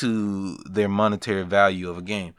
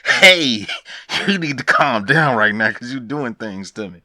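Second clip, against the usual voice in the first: a put-on, breathless voice warning her off playfully; telling Jack to calm down, mock flustered; flustered flirting; 3 dB louder than usual. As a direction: act teased and turned on. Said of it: "It's like".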